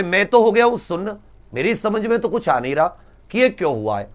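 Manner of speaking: ordinary delivery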